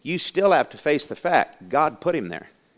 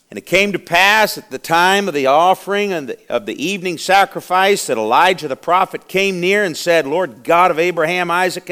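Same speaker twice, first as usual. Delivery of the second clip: raised, forceful pulpit reading; preacher's scripture reading; 4 semitones higher, 5 dB louder than usual. Acted. Preacher reading Elijah's prayer to the church, fervent proclamation. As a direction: proclaim the verse to the congregation, higher and louder. Reads scripture aloud in the sermon.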